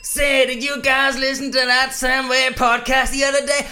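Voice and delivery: hammed-up broad American accent. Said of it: "broad, probably offensive, uh, American accent".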